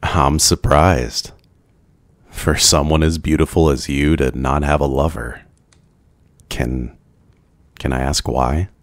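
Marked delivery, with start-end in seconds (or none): none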